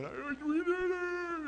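high, drawn-out triumphant yell; Rocky's victory scream. Retold or imitated. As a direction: scream it like a boxer who just survived. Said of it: "and he's screaming".

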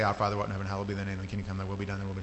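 mindless rote gabble on a low pitch; rattled-off prayer. Shown as a demonstration. Showing prayer turned into repetition, mechanically rattled off.

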